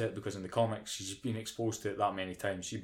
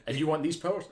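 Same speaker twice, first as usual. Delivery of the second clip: higher, eager begging voice; whiny pleading Trish; 6 semitones higher than usual. Retold or imitated. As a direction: plead to be the sidekick. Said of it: "Trish parody resumed".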